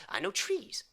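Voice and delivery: ordinary talk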